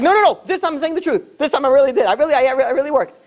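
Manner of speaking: high, pleading insistence; desperate protest. Retold or imitated. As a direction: beg Dad to believe you, voice leaping up. Son insists he truly worked.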